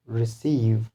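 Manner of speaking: re-CEIVE, weight on the end of receive; second-syllable stress. Shown as a demonstration. Teacher gives an example of a verb, stress on CEIVE in receive. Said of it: "stress will be on second syllable".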